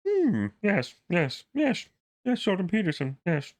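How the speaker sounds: lightly voiced, high mock Peterson; a half-hearted take on a professor's measured yes; Jordan Peterson impression. Mock Peterson voice to the viewers. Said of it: "I probably sounded nothing like Jordan Peterson".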